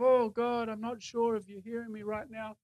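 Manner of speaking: doubtful prayer. Acted. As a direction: trail away as if unsure anyone hears. Voicing a doubtful prayer to God.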